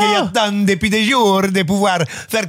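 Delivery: put-on comic Italian-American accent; mock Italian accent. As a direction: lay on a fake Italian accent like an American actor. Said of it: "qui sont avec leur accent".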